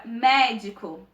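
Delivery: "médico" with a strong, lengthened "mé"; stress on first syllable. Shown as a demonstration. Teacher giving a proparoxytone example, "médico" stressed on "mé". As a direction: punch the "mé" of "médico". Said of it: "a sílaba mais forte é o mé".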